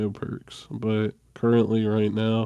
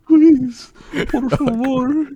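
put-on tiny pleading child; 7 dB louder than usual. Small child pleading for water.

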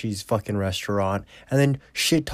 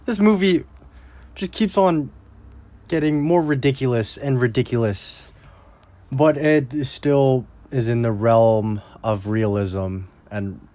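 ordinary delivery

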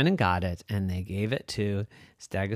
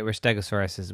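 usual speaking voice

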